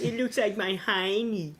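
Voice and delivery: high, slow squawky seagull voice; seagull impression. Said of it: "That's my seagull impression".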